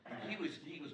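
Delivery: ordinary talk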